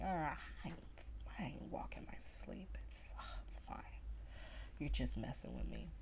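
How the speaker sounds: soft, breathy brush-off; dismissive whisper. Retold or imitated. She dismisses her dad's sleepwalking claim, whispered shrug. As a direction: wave it away under your breath.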